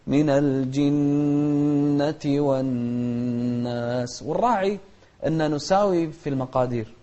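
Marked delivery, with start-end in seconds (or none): 0.1-4.2 the doubled nun in الجنة and الناس held with two counts of nasal hum; nasal ghunna. Shown as a demonstration. Teacher showing the two-count ghunna on the doubled nun. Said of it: "نوحد المقدار".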